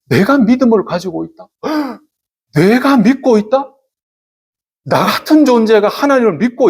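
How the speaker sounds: high-pitched, incredulous questions; demonstrated amazement. Shown as a demonstration. Preacher showing believers what to marvel at, amazed. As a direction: marvel at your own belief, pitch climbing. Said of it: "어디에서 많이 놀라야 되느냐면은".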